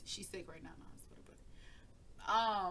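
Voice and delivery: hushed unvoiced aside; whisper aside. Real, not acted. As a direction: drop to a whisper to the side.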